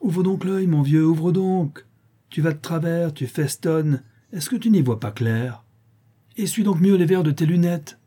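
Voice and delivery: put-on high-pitched taunting boy; jeering child's cry. A narrator's reading. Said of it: "il s'écria".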